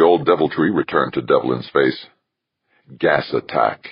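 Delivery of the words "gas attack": ordinary delivery, nothing marked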